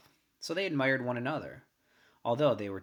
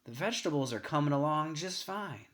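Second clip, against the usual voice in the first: lightly voiced slow father; 5 semitones higher than usual. A narrator's reading. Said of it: "he might say to her real slow".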